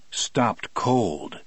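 plain reading voice

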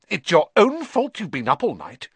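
a mild stern mum giving orders; Mrs Weasley scolding. A narrator's reading. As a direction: lay down the law to lazy sons.

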